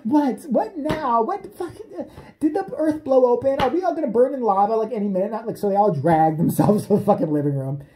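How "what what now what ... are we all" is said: high-pitched startled what; mock alarm. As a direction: squeak out baffled alarm.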